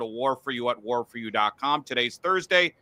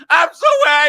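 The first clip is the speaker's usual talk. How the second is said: high, loud whiny mock sob; mocking crybaby; 13 semitones higher, 12 dB louder than usual. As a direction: whine it like a crying celebrity. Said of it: "And he's like".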